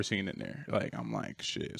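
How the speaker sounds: soft unvoiced under-the-breath curse; muttered curse. Driver seeing a packed freezer, muttering under his breath. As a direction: breathe it out like a private groan. Quiet dismay at crowded freezer.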